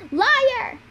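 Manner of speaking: high, loud one-word outburst; angry accusation. A narrator's reading. Annabeth rejecting Luke's claim about Talia, angry accusation. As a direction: hurl the accusation high and hard.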